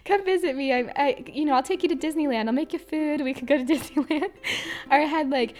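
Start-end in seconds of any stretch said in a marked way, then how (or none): 0.0-4.3 put-on higher, louder sweet old caller; lonely caller begs her to visit, eager and sweet; imitating eager caller. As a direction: coax her to come visit, pleading. Caller promising Disneyland.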